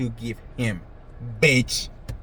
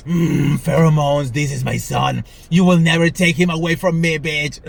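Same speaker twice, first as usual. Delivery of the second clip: mock possessive mom; 2 semitones higher, 6 dB louder than usual. Mom voiced nuzzling son's neck.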